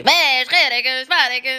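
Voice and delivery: imitating a rough Libyan accent. Tunisian vlogger imitating a Libyan speaker: Libyan accent. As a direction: Libyan accent, mock tough and irritated. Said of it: "نحسهم هكا كلهم عنف وعصبية".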